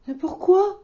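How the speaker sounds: honestly lost; puzzled wolf. Acted. Loup asks why children fear wolves, high and puzzled.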